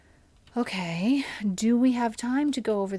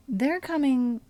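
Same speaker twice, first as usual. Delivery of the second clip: ordinary delivery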